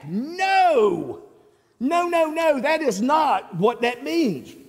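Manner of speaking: high, insistent repeated "No"; emphatic no. Shown as a demonstration. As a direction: press every "No" firmly, rising up. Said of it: "this is emphatic".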